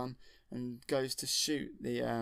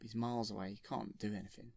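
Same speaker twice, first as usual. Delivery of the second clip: usual speaking voice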